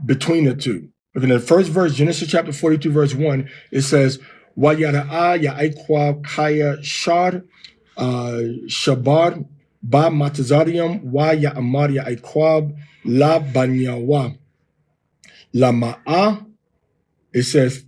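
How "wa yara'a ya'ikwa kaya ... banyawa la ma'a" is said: slow, careful Hebrew recitation; chant-like scripture reading in Hebrew; One West Hebrew reading. Shown as a demonstration. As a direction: read the verse slowly in Harlem One West Hebrew. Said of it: "I'm gonna read this in the form of Hebrew that we were taught at One West".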